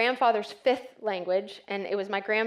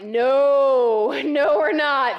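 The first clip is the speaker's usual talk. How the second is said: emphatic no; 4 semitones higher, 9 dB louder, much slower than usual. Retold or imitated. Answering if Journey is non-denominational.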